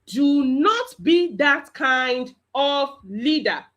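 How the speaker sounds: "Do not be that kind of leader" very slow, word by word; emphatic warning. Real, not acted. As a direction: drive home each word as a stern teacher. Teacher repeats the warning more emphatically.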